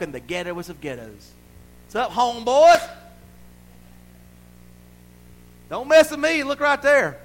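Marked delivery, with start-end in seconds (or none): 1.9-7.2 high, loud mock swagger; cocky shout. Protected man taunting homeboys, cocky shout. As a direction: holler it like you own the street.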